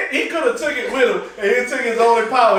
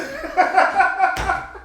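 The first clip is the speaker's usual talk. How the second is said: laughing; 10 semitones higher than usual. Real, not acted. Reacts to movie scene.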